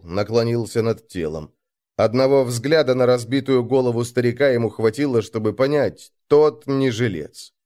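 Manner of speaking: ordinary delivery, nothing marked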